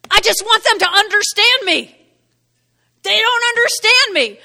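high, loud mock whine; hammed-up protest of the misunderstood; mock complaining. Someone who wants to be understood, mock complaining. Mimics people demanding to be understood.

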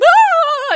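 high, loud, drawn-out mock wail. Mother calling her dear friend in distress, mock wail. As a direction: imitate a panicked cry down the phone.

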